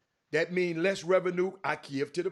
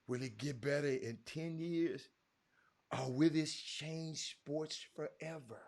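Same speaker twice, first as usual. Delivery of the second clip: hushed worried tone; 5 semitones lower, 6 dB quieter than usual. Retold or imitated. Caller imitating his worried league contact, lowered and softer. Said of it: "he was just talking like this to me".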